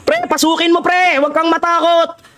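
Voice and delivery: high-pitched pep talk; rallying urge. Real, not acted. Streamer urging a teammate to charge in, rallying.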